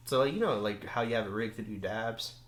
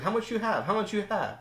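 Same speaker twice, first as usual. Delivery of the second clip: put-on higher voice for the old madam; imitating the lady; 9 semitones higher than usual. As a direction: mimic her pushy question. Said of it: "She's like".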